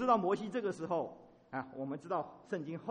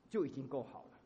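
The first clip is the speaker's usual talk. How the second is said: ordinary talk, nothing marked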